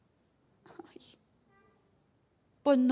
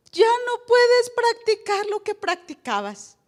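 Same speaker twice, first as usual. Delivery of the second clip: higher, louder, slow preaching cry; climactic cry; 8 semitones higher, 8 dB louder, slower than usual. Real, not acted. Pastor's climactic point. (clamor de predicador, alto y lento)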